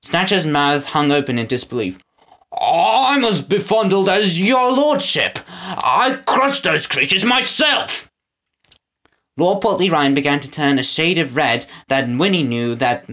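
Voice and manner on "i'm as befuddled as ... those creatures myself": befuddled Snatcher. A narrator's reading. Snatcher caught in a lie.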